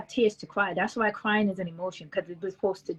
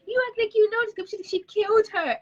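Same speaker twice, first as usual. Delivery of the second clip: incredulous high-pitched disbelief; 13 semitones higher than usual. Retold or imitated. Mother mocking daughter crying over a TV death, high incredulous voice. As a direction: voice jumps way up in mock disbelief. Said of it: "I'm like".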